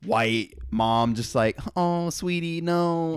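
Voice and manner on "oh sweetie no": higher, cooing mock mom voice.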